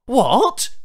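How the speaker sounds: can't believe what he hears; stunned human asking back; disbelieving. A narrator's reading.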